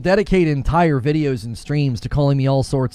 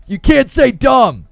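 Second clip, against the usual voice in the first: loud, higher mock scolding; mock outrage; 8 semitones higher, 8 dB louder than usual. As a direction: shout the silly rule in fake indignation. Louder repeat of mocked rule.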